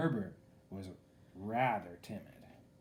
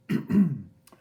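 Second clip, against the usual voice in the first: loud, deliberate ahem; polite throat clearing; 4 semitones higher, 14 dB louder than usual. A narrator's reading. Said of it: "Herbert coughed politely".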